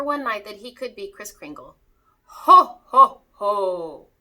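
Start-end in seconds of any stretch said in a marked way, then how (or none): 2.2-4.1 booming Santa laugh. Acted. Murray finally feels like Santa, booming loud laugh. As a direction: let the big Santa laugh roll out. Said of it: "Murray laughed".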